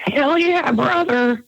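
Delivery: lightly gravelly wrestler voice; Hollywood Hogan. Pretend. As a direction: growl it like a pro wrestler. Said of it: "can you give us a little Hollywood Hogan".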